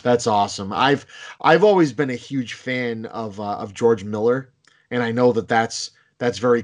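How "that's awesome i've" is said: usual speaking voice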